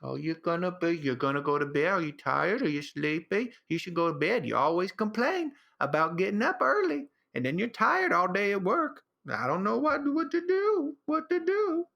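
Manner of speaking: rising to a high silly whine; baby talk climbing high to annoy girlfriend. Retold or imitated. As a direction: pester her with mock baby talk. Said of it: "more annoying voices".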